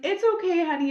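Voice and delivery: high, loud imitation of mom soothing; consoling mother. Mom consoles crying daughter, mock soothing. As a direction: coo comfort in an exaggerated mom voice.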